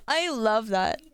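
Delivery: slow, laughing delight. Real, not acted. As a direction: laugh through the words. Laughing at chat story.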